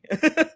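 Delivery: short high-pitched laugh; high giggle. Real, not acted. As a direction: burst into a giggle. Laughs mid quote.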